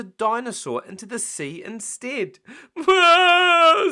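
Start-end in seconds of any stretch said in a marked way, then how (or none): 2.8-3.9 put-on high loud toddler wail; mock sob. George wailing as his dinosaur floats away, high loud sob. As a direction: wail for the lost toy. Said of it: "sobbed George".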